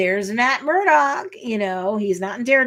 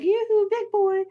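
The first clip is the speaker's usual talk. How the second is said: flirty yoo-hoo; 12 semitones higher than usual. Retold or imitated. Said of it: "you know, like".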